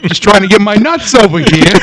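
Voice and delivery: put-on high, loud New York accent; indignant squirrel with a New York accent; New Yorker squirrel. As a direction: squawk it like an annoyed New Yorker. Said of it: "squirrel that talked like a New Yorker".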